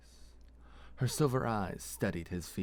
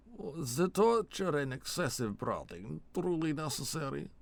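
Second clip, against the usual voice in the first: put-on high, appalled Elkexi-accented question; Elkexi accent, disapproving; 8 semitones higher than usual. Vold sickened by his partner's torture, Elkexi accent.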